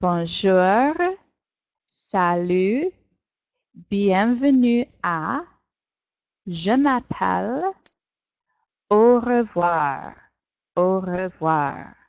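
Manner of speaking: each French phrase slowed and drawn out; teacher leading a slow French read-along. Shown as a demonstration. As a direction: read the French lines slowly together. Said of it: "lis avec moi, read with me".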